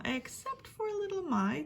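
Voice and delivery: ordinary delivery, nothing marked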